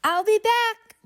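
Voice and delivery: put-on a happy boy calling goodbye, lightly shouted; shouting. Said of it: "Billy shouted".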